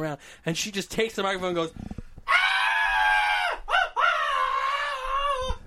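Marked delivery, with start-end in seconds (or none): 2.3-5.6 very high drawn-out mock shriek; long piercing wails, hammed up; imitated screaming. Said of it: "just screaming".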